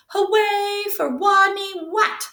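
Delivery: high, slow sung "Hooray for Wadney Wat!"; cheering. Acted. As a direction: cheer it like a chant for a hero. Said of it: "they cried".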